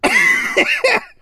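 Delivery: laughing while choking on a drink; cough-laugh after a gulp. Real, not acted. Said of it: "I'm choking 'cause I took a gulp".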